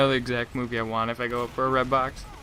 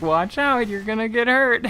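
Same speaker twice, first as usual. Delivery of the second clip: put-on higher and louder, an old lady warning a kid; 8 semitones higher, 4 dB louder than usual. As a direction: fuss at a kid poking you, as an old lady. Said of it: "it's this old lady too".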